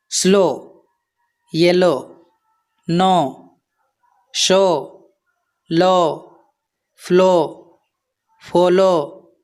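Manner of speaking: स्लो, येलो, नो, शो, लो, फ्लो, फॉलो, each ending in "oh". Shown as a demonstration. Teacher showing learners OW words, स्लो to फॉलो with "oh" endings. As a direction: say स्लो to फॉलो one by one, closing on a long "oh". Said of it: "O होता है".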